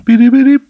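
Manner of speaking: loud high beep beep beep sound effect; imitated beeps. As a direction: make the censor bleep with your voice. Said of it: "you know, that part".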